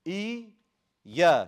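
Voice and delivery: "E, ya" split in two, high "E" then loud punched "ya"; two yas shown. Shown as a demonstration. Teacher shows there's two yas, split with emphasis. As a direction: break it apart and hit "ya" hard. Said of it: "Show that there's two".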